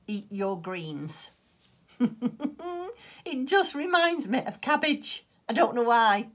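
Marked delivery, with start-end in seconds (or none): 2.0-3.2 bright high giggle; a quick delighted laugh; amused laugh. Real, not acted. Laughing at her yarn name. 3.2-6.3 said high and loud through a laugh; tickled by her own yarn name; amused, laughing. Real, not acted.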